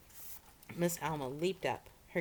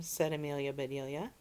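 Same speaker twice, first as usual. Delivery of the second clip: plain reading voice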